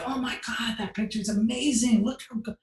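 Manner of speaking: high, airy mock compliments; fake gushing. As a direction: gush over the photos while searching. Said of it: "And I'm like".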